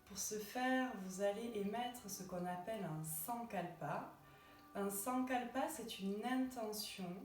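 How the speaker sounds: unhurried relaxation voice with long pauses; guided meditation. Acted. Yoga teacher guiding listeners lying in Shavasana, calm guided meditation. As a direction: guide them gently into rest, phrase by phrase.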